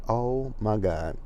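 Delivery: slow, drawn-out exasperation; exasperated reaction. Retold or imitated. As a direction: stretch each word out in disbelief. Retold exasperated reaction to fake crying.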